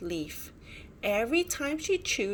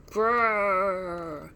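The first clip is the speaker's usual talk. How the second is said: growl; 6 dB louder than usual. A narrator's reading. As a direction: growl like a hidden monster. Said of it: "came the noise again".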